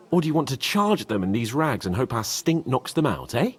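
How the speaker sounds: ordinary talk, nothing marked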